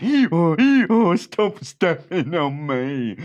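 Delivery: loud high hee-haw, then slow words; braying donkey. Acted. Stepped-on donkey brays at the bandit. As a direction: bray then complain. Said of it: "And the donkey said".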